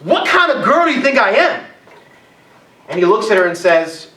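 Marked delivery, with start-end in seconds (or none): 0.0-1.7 higher, offended retort; hammed-up indignant woman; mock outraged woman snapping back. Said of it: "she gets super pissed and she's angry".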